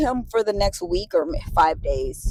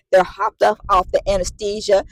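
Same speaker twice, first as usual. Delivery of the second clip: ordinary delivery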